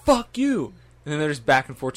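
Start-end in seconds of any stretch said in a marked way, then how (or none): 0.0-0.7 high mock yell. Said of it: "he's just like".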